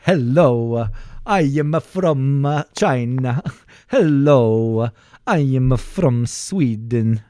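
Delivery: slow, bouncing Swedish lilt; exaggerated slow Swedish singsong; Swedish accent. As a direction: copy a train-rhythm Swedish accent. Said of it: "how you do a Swedish accent".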